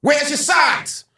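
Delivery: high, loud, drawn-out director's demand; Spike Lee. Retold or imitated. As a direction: demand it slowly at a high-pitched yell. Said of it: "Spike looks at me and goes".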